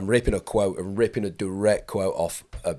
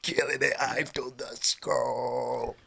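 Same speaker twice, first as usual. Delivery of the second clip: mock cocky newbie; 2 semitones lower, 6 dB quieter, slower than usual. Skydiving instructor mocking arrogant new arrivals, slow boastful swagger. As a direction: lay on the smug rookie bravado.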